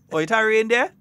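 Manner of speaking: high, loud question in Grenadian dialect. Retold or imitated. Caller to Saint John asking about rain, Grenadian dialect. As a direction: ask it in broad Grenadian everyday talk. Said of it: "That's how we just talk".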